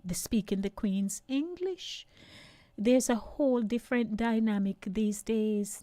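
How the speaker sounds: ordinary talk, nothing marked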